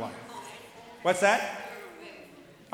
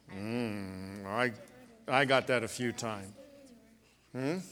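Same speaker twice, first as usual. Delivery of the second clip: usual speaking voice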